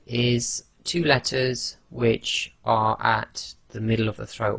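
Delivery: usual speaking voice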